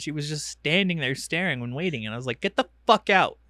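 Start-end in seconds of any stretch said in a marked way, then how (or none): 2.4-3.4 loud, higher, annoyed order; retold yell. Annoyed parent ordering a staring kid out, yelled. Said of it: "I yelled at Rin the other day".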